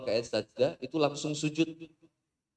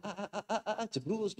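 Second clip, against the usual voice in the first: panicked ah cries; 6 semitones higher, 4 dB quieter than usual. Retold or imitated. Motorbike losing control.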